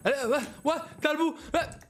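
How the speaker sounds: high exaggerated gasps of wonder; mock amazement. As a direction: act out being dazzled. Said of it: "أنا رحت قلت لها". (دهشة مصطنعة مبالغ فيها)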